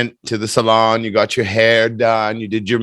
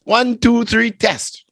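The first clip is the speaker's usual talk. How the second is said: sing-song mic check pitched up high; playful one two three test; mic check demo; 11 semitones higher, slower than usual. Shown as a demonstration. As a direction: count it out brightly for the mic. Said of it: "Let's do a test".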